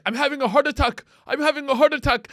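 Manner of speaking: high, fast alarm; imitated panicky cry for help; panicked man. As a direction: call out urgently as if in danger. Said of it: "he was like".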